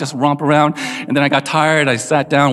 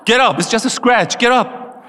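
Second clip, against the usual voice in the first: higher-pitched, impatient mock scolding; scolding father; 5 semitones higher than usual. Father to crying son with scraped knee, mock scolding. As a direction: imitate a dad ordering a child up.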